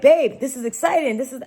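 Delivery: high and loud, imitating the husband's cheer; a hint of the husband hyping her up; excited husband. Husband cheering his stunned wife, in excited tones.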